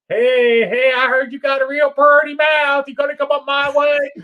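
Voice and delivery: high, loud, mocking backwoods shout; hammed-up Deliverance-style holler; hollering good old boy. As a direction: taunt the convict from the porch. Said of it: "he could do it better".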